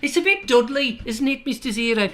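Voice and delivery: put-on high catchphrase voice in Dudley accent; mock Dudley accent. Getting into the accent by catchphrase, high mock Dudley. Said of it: "I'll probably go into, into Mister Zero".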